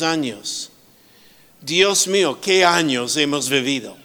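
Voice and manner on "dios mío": ordinary talk, nothing marked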